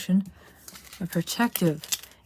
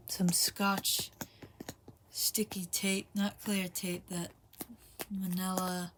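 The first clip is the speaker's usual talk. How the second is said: whispering; 12 dB quieter, slower than usual. Real, not acted. Whispering while taping the card.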